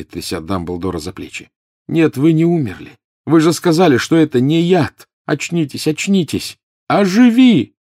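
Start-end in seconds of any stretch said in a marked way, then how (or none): 1.9-7.7 high, loud frantic cries; desperate shouting. A narrator's reading. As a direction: shout it in panic. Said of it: "выкрикнул он". (отчаянный крик)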